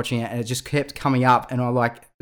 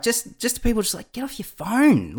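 annoyed; 10 semitones higher than usual. Retold or imitated. Annoyed people telling others off.

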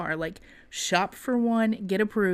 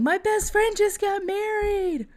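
imitated coaster yell; 13 semitones higher, 4 dB louder than usual. Bride retelling friend's shout on the coaster, mock yelling. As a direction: holler it high, imitating a thrilled rider. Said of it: "while on the coaster yelling".

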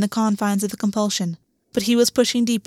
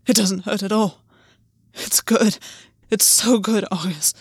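aroused, pleading. Acted. Aroused lover pleading, breathy.

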